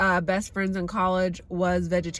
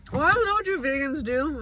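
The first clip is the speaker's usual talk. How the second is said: mock retort; 6 semitones higher than usual. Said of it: "retorting asker".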